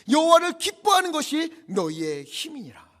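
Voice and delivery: high, loud exhortation; full-force preaching. Acted. Preacher urges the weeping people to stop grieving, full-force preaching. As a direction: cry it out to a weeping congregation.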